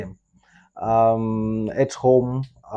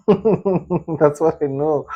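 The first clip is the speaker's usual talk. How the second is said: laughing; 5 semitones higher, 4 dB louder, much slower than usual. Real, not acted. Laughs at the surprising name.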